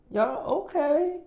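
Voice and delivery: high rising okay; impressed squeal. Retold or imitated. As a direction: squeal it up in amazement. Girlfriend impressed by the babies.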